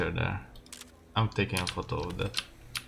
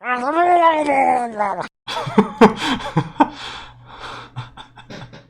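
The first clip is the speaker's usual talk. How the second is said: big shouting laugh, much higher; loud laugh; 8 dB louder than usual. Real, not acted. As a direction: bursts out laughing loudly. Laughing at the water-bottle clips.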